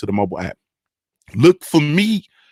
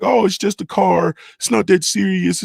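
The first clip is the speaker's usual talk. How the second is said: dismissive people; 8 semitones higher than usual. Retold or imitated. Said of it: "I know people be like".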